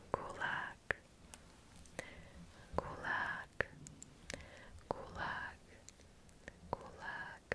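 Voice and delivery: very soft, breath-only repetitions; ASMR whisper. Acted. ASMR host repeating Russian word for listeners, soft whisper.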